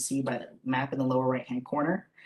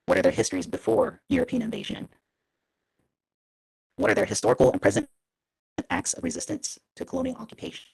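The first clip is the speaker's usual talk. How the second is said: robot voice; 9 semitones higher, faster than usual. Real, not acted. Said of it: "I sound like a robot with the other microphone".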